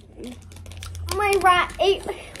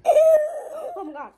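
disgusted shout; 8 semitones higher, slower than usual. Real, not acted. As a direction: howl at the taste. Reacting to disgusting bean.

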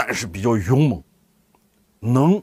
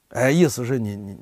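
ordinary talk

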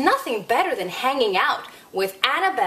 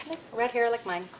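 plain reading voice

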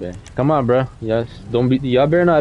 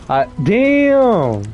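surprised exclamation; 9 semitones higher than usual. Real, not acted. Sudden surprise during car meet.

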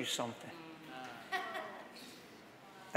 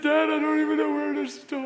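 son's plea, much higher and louder; pleading son; 12 semitones higher, 7 dB louder than usual. Retold or imitated. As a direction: plead like a broken son asking for a hug. Son breaks and cries at door.

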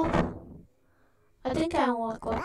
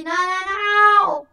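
loud, slow, higher panicked cry; 6 semitones higher, 7 dB louder, slower than usual. Real, not acted. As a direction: yell it in sudden panic. Panics as he's spotted.

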